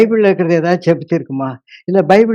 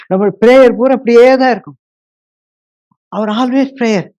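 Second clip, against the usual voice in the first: raised preaching voice; 6 semitones higher, 3 dB louder than usual. Acted. Preacher scolds congregation.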